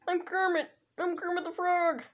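high puppet frog voice; Kermit the Frog voice. Retold or imitated. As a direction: squeak it out like Kermit. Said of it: "I can actually do a Kermit the Frog voice".

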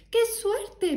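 high, loud delighted cry; excited child. Acted. Teo thrilled to go to market, high excited cry. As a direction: burst out with a child's joy.

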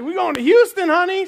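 high, loud, eager question to his wife. Retold or imitated. Pastor asking his wife about moving, loud eager question. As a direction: pitch the move brightly. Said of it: "I was like".

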